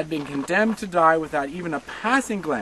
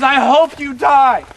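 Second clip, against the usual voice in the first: slow, loud and higher, every word hurled; furious boy; 9 semitones higher, 13 dB louder, much slower than usual. Retold or imitated. Furious son shouting at his mother as they're separated, slow and loud. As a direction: hurl it at her slowly in fury. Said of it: "he turns around and says".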